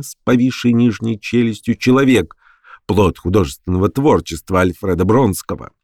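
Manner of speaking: ordinary delivery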